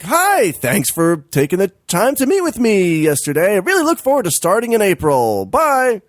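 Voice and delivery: high, sugary fake politeness; chirpy high mock-polite reading; overly cheery email voice. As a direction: read the email in a bright fake-nice voice. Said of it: "emailed her ... being like".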